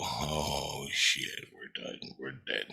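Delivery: whispered, as if doomed; hushed dread. Retold or imitated. Dreamer to buddy facing Godzilla, hushed retold dread. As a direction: breathe it out in a frightened whisper.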